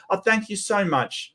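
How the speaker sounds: ordinary talk, nothing marked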